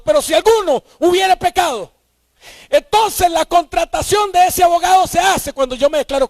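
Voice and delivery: fervent sermon push; preaching shout. Acted. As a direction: push it out to the back row.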